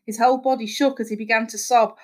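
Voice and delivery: ordinary delivery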